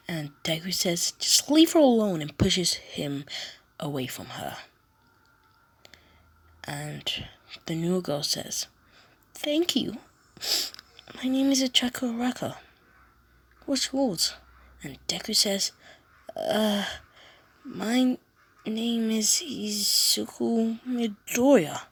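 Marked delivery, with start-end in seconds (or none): 1.2-2.2 high, loud protective yell; loud shout. Acted. Deku yelling at Bakugo to leave the girl alone. Deku repeats defense louder. 9.3-14.3 put-on high, light young girl voice; girl's voice. New girl thanking Deku in a high girlish voice. 16.3-21.8 higher, slow and hesitant; a nervous boy introducing himself; shy boy voice. Acted. Deku shyly telling the girl his name.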